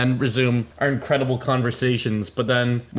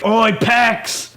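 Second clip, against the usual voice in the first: put-on higher-pitched drunken hail; rowdy cheer; 6 semitones higher, 3 dB louder than usual. Drunk weebs toasting a stranger at Pax, rowdy cheer. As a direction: call it out like a toasting reveller.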